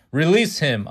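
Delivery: loud, commanding order; princess orders. Acted. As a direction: command the guards to let him go. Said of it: "I ordered".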